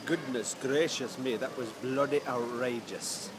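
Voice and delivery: slow, hammed-up Indian accent; bad Indian accent. Joking verdict after the buffet, bad Indian accent. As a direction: put on a terrible Indian accent, drawn out. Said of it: "the worst Indian accent I've ever done".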